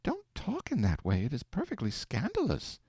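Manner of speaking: a scandalised wife, recoiling; shuddered protest. A narrator's reading. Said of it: "Aleck shuddered and said".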